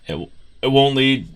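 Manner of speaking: talking through hiccups; hiccuping mid-sentence. Real, not acted. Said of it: "excuse me, I have the hiccups".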